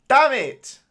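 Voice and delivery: loud, high cry of frustration; frustrated shout. Real, not acted. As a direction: bark it out in real annoyance. Conceding/losing the match.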